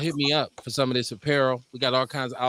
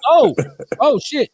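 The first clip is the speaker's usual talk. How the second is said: surprised; 10 semitones higher, 8 dB louder than usual. Real, not acted. Sudden realisation.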